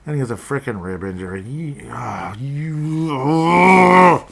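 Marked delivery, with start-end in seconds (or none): 1.4-2.3 whisper. Real, not acted. Frustration builds to outburst. 2.4-4.2 loud, high strangled yell; real fantasy-owner rage yell; frustrated yell. Real, not acted. Frustrated outburst.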